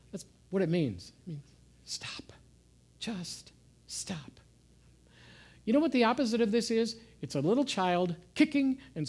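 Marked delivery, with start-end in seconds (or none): 1.3-4.4 hushed whisper, stop said softly. Acted. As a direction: drop to a breath-only whisper to calm them. Preacher drops to a whisper.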